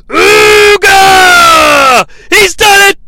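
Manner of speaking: high, loud victory yell; excited shout. Acted. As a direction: scream the win like a commentator. Victory call in commentary.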